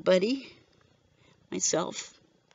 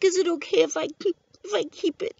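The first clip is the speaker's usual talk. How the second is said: high, tearful plea, voice near breaking; tearful asking; 8 semitones higher than usual. Retold or imitated. Widow asks the deputy to keep her husband's shirt, tearful and high. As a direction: ask it through tears, barely holding on. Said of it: "And I'm crying".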